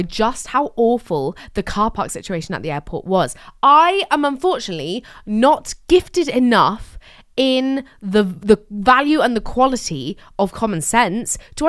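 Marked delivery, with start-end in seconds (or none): none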